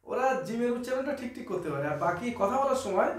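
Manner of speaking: normal talking voice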